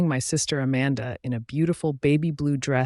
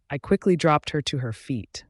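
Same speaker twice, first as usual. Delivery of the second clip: ordinary delivery, nothing marked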